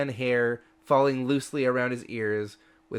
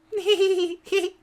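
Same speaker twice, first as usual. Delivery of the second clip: high-pitched manic giggle; maniacal giggling; 3 dB louder than usual. Acted. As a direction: giggle like a mad little pixie. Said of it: "a maniacal giggling".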